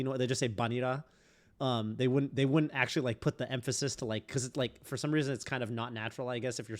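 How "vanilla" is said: "banira" with a B in place of V; Japanese-style "banira". Shown as a demonstration. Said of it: "they just use a B".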